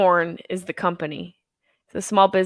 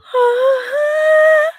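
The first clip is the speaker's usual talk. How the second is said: high loud wailing cry; mock anguished wail; 7 dB louder than usual. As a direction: wail along with the music. Dramatic wail over music.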